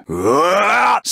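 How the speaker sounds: long drawn-out high cry of pain; anguished battle scream. Acted. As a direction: let the hit tear a slow scream out. Character hit in battle.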